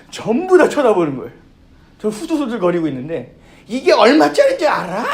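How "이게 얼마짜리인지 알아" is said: imitating a higher, louder furious demand; yelling at the waiter. Customer screams at the young waiter over her dress, furious yell. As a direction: scold him like a rich customer. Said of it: "그리고 소리를 지르더라고요".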